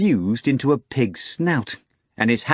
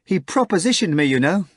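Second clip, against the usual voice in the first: Natasha, giddy maid; 7 semitones higher than usual. Acted. Maid confessing to her mistress, high and gleeful. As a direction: rattle it off in delight.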